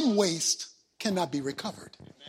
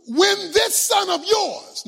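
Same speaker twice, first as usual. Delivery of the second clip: higher, louder aggrieved shouting; angry older son; 8 semitones higher, 5 dB louder, slower than usual. Acted. Older son shouting at his father over the party, angry. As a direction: rail at your father, wounded and furious. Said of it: "Verse thirty".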